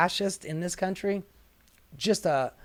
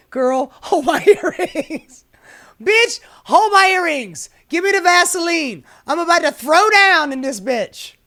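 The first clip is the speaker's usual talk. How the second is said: put-on high, loud sassy girl ready to fight; high loud fighting-talk sass; 8 semitones higher, 8 dB louder than usual. Playing the sign's sassy girl.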